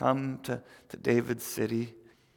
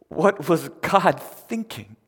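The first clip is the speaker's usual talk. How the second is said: loud, slow, raised disbelieving question; baffled outcry, each word weighted; acted incredulity; 5 semitones higher, 8 dB louder, much slower than usual. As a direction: stretch it out in loud bewilderment. Imagines Mary's incredulous question.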